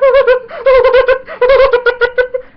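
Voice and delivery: loud, high, long cackle; high staccato giggling fit; idiotic laughing. Retold or imitated. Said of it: "laughing like an idiot".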